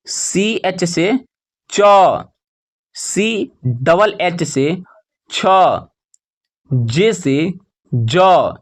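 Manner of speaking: slow chant of spelling, then the letter held long; measured classroom recitation with pauses; spelling drill. Shown as a demonstration. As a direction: land firmly on each drawn-out consonant.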